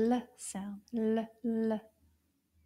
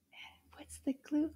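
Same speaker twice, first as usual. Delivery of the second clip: whisper; 4 semitones higher, 7 dB quieter than usual. Shown as a demonstration. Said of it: "teacher whispering".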